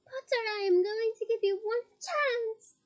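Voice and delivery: high, loud threatening shout. Acted. Bellatrix shouting a threat at Harry. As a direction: bark the threat at Potter across the hall. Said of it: "shouted Bellatrix".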